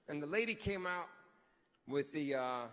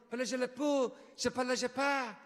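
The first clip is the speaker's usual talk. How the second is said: mock French chatter; 3 semitones higher, 3 dB louder than usual. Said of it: "the lady keeps going".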